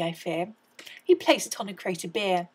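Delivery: ordinary delivery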